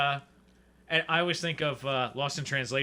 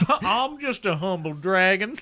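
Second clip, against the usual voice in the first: slow, louder humble southern dragon; southern accent; 4 semitones higher, 4 dB louder, slower than usual. Pretend. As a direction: drawl it out like a meek Southern dragon. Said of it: "Why are the dragons southern and talking?".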